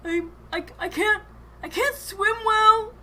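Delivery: high, stammering cry from down the well; high-pitched halfling in trouble, voice climbing; panicked halfling. Acted. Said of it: "the voice of the halfling".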